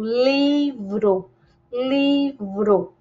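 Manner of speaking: "livro" drawn out as li-vro; syllable by syllable. Shown as a demonstration. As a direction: sound out "livro" in two slow beats. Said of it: "Quantos sons eu emito?".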